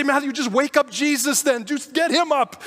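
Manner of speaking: mock yell. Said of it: "And then they say".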